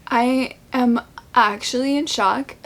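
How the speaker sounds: normal talking voice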